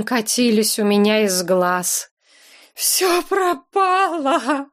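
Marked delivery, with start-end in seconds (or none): 2.7-4.7 high, slow sobbing wail. A narrator's reading. Heroine sobbing to the dog owner, high slow sobbing wail. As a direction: sob the words out, drawn out and broken. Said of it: "сквозь рыдание твердила я".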